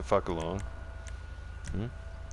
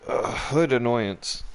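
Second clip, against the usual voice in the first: slow scornful put-down; 5 semitones higher, slower than usual. Real, not acted. Streamer mocking wannabe gangsters in his lobby, slow and scornful. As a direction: drag it out slowly, word by word.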